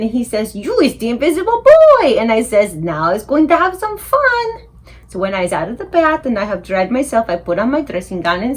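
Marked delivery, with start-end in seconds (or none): 0.6-2.2 high, loud amazed exclamation; father bursting with his discovery; excited. Acted. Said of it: "My daddy is squiffy with excitement". 2.7-4.7 high, drawn-out relish; delighted boy plotting fun; gleeful. Acted. Boy gleeful at his new power.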